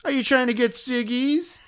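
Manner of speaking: high, blaring put-on holler; badgering drunk woman. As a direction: imitate a loud drunk pestering strangers. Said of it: "she looked at us and she's like".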